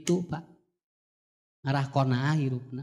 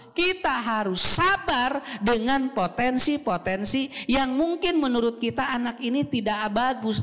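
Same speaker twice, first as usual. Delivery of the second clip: fervent preaching; 7 semitones higher than usual. Acted. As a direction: drive the point home to the parents. Preacher builds to full force.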